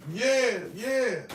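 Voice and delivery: put-on high, eager yeah yeah from the boys; eager kids agreeing. Boys egging the old lady on, high and eager. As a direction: chime in like excited kids.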